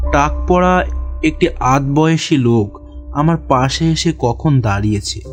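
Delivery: plain reading voice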